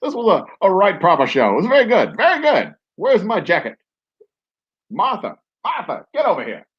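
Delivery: put-on high old-timey gent voice; 1920s gentleman voice. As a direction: lift into a grand old-Hollywood voice. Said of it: "Almost to Cary Grant".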